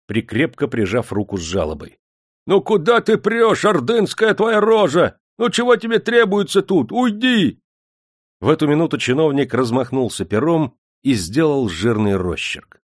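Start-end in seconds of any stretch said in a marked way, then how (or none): 2.5-7.6 high, loud rough soldier's yell; angry soldier. A narrator's reading. Soldier holding the door against the Bashkirs, loud angry yell. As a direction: bark them out of the door.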